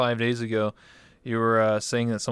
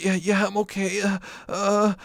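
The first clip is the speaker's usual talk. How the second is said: dazed moaning stranger; 10 semitones higher than usual. Retold or imitated.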